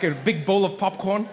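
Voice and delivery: usual speaking voice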